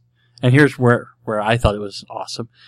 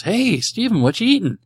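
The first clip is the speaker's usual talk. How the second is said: high, singsong cartoon question; high-pitched quoted hello; cartoon greeting; 11 semitones higher than usual. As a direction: imitate a chirpy passer-by, pitched way up. Voicing a cartoon character's greeting.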